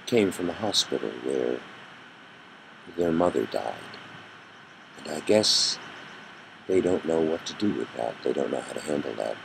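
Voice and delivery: soft, halting, low and subdued; a dazed father barely getting the words out; quiet grief. Retold or imitated. Grieving father apologising to Covey, quiet and broken.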